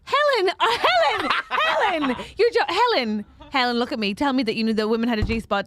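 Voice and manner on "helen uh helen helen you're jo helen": high, incredulous calls through laughter; laughing disbelief. Real, not acted. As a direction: call your friend to account, pitch soaring. Incredulous at friend's confusion.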